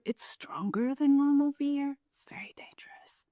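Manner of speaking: unvoiced whisper, conspiratorial; secretive beer warning; whispered aside. Real, not acted. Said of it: "aside about beer strength".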